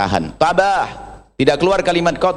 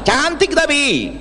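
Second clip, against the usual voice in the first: ironic drawl; 11 semitones higher, much slower than usual. Real, not acted. Ironic drawn out remark.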